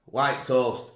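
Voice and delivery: usual speaking voice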